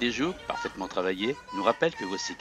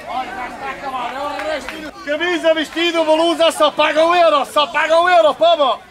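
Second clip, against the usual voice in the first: loud high hawker patter; haranguing the crowd; 11 dB louder than usual. Real, not acted. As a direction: call out bargains over the market noise. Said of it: "les camelots haranguent la foule".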